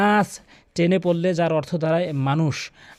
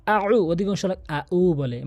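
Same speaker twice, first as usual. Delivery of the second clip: usual speaking voice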